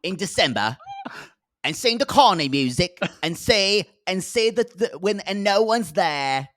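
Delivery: lightly voiced English host imitation; mock English accent. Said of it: "Michael's like".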